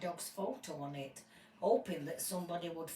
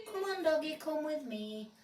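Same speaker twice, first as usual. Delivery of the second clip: a gentle call to a stray dog; coaxing lady; 8 semitones higher than usual. Acted. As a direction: coax the little dog to come.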